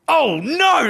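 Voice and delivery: sudden high panicked "oh no"; alarmed cry. Retold or imitated. Waking in the hammock to rain, panicked cry. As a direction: jolt awake and cry out in alarm.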